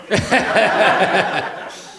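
high, loud falling laugh; real laugh breaking through; burst of laughter. Real, not acted. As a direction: laugh it out at yourself. Laughing at own story.

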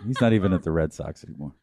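ordinary talk, nothing marked

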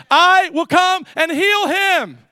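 loud, high, slow proclamation; spoken-word declaration. Acted. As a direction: proclaim each word with full authority. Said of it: "this is the spoken word".

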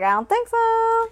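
high, singsong put-on refusal; mock refusing mother. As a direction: turn the girl down in a high voice. Voices mother refusing the daughter.

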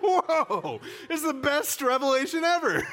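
high, loud, laughing awe; laughing wonder at a revelation; laughing amazement. Retold or imitated. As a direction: burst out delighted and amazed. Retelling his own reaction.

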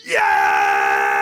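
huge, loud victory shout; triumphant yell. Retold or imitated. Dad finding his lost glasses in the ocean, a huge triumphant shout. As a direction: let out a booming cry of triumph.

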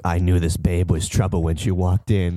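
spoken like a film noir narrator; noir monologue. Acted. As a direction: lightly play the hard-boiled voice-over, no melody. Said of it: "it's like the monologue in every noir movie".

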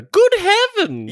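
high, loud, drawn-out British mimicry; over-the-top imitation of a British cry; mock British exclamation. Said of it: "still upset just by the".

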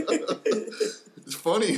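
laughing it out, high and slow. Real, not acted. Host still laughing at his own joke, high and drawn out. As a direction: squeeze the words through real laughter.

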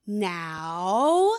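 slow, singsong teacher reminder. Shown as a demonstration. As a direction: patiently coax a child, stretching the words. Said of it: "I got my teacher voice".

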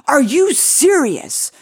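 indignant mock outburst, high and drawn out; Naftali Bennett. Podcaster mimics politician berating a TV host, outraged high voice. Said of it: "Naftali Bennett was, like, so mad".

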